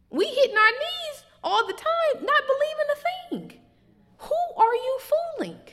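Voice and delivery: high, loud rising preacher's cry; full-force preaching. Acted. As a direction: build the rebuke to a climax.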